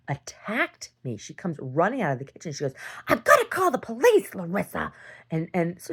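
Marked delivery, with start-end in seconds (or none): 3.1-4.9 high, loud mock scream; imitating aunt's scream. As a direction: shriek the threat like the aunt. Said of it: "She's screaming this at me".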